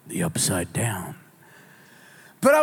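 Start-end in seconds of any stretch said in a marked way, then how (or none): 0.1-1.1 hushed, eerie whisper of the name; spooky whisper. Pretend. Preacher whispers the show's name eerily. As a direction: breathe it like a horror trailer. Dramatic repeat of show name.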